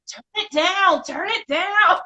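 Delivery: high, loud imitated yell; retold yelling. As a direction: yell it like an annoyed player over voice chat. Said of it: "people are yelling".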